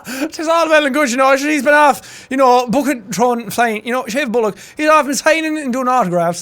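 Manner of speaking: high-pitched broad Irish impression; exaggerated Irish mimicry; Irish accent. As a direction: babble like an Irish rower. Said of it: "this is one of those accents".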